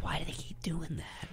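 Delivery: breathy whispered question. Real, not acted. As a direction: whisper it as an aside. Unvoiced whisper measured.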